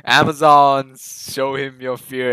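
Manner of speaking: loud, drawn-out call on the first word; mock war leader calling out to the troops; imitated rallying call. Film fan quoting the queen addressing Amazons, loud imitated rallying call.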